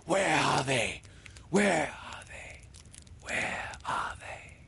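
high cry fading to a slow, voiceless echo; savage echoing cry. A narrator's reading. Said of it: "echoes seemed to cry savagely".

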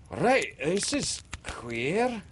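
high, slow gasp of bewilderment. A narrator's reading. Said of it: "Smee gasped".

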